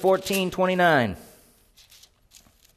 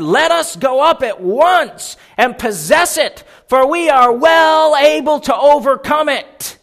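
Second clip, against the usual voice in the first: bold Caleb; 6 semitones higher, 5 dB louder, slower than usual. Acted. Caleb's bold call read.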